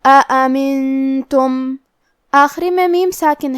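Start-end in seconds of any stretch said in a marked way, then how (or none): 0.0-1.8 slow chanted recitation, the nasal sound held long; tajweed recitation. Shown as a demonstration. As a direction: recite it slowly and hold the nasal hum. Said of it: "غنہ کے ساتھ یہ حکم کو ادا کریں گے". (آہستہ، غنہ کے ساتھ تجوید والی تلاوت)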